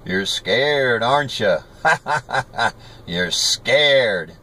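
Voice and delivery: imitated bully's taunt with a laugh; mocking taunt. Frank calling after the boy running home, taunting. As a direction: jeer like someone calling after a fleeing kid. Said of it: "hearing him throw his voice going".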